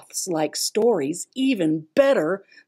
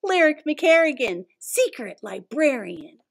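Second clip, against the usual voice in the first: dramatic title announcement; 5 semitones higher than usual. Acted.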